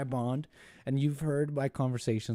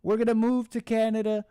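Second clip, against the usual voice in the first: higher, louder mock whine; higher, louder mocking liberal voice; mock protester; 6 semitones higher, 4 dB louder than usual. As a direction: ham up a dramatic complainer. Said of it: "they say".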